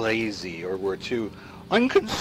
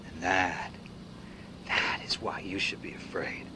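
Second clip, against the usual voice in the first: lower, whispered warning; menacing whisper; 8 semitones lower, 8 dB quieter than usual. Acted. Concluding why we should fear, in a menacing whisper. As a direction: whisper it like a secret threat.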